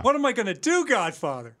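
high, loud crooner plea; Johnny Fontaine impression. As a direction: beg like a washed-up crooner. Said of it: "are you a professional imitator".

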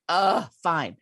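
loud exasperated groan of giving in; grudging surrender. Retold or imitated. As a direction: re-enact caving with a big huff.

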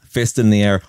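ordinary talk, nothing marked

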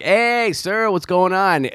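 a hint of fake cheer; faked cheery greeting. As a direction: greet him with forced cheer. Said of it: "and I'm like".

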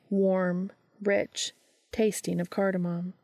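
usual speaking voice

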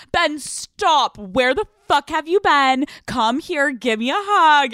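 high, loud over-the-top greeting; mock eager reunion; gushing. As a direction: overdo the delight at seeing him. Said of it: "And you're like".

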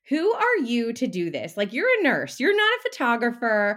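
the doubting brain mocking a would-be photographer; nagging inner critic. Brain scolds the nurse turned photographer, scornful and pushy.

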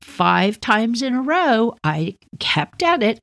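ordinary talk, nothing marked

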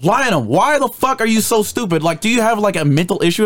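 high-pitched, exasperated instructor rant; imitated berating instructor. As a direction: chew out a hopeless recruit. Instructor berating recruit, acted out.

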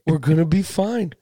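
usual speaking voice

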